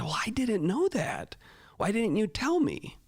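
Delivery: high-pitched retold questioning; surprised protest. Teacher retells surprised reply to student.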